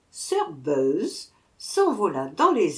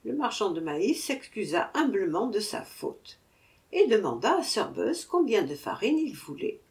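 usual speaking voice

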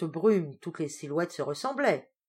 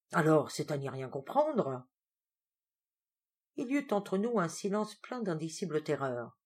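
ordinary delivery

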